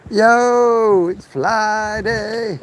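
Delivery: high, drawn-out hype intro; high energetic greeting. Real, not acted. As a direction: sing out the intro slowly.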